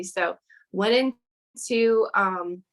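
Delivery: normal talking voice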